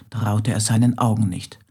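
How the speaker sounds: ordinary delivery, nothing marked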